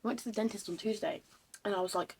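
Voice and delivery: ordinary talk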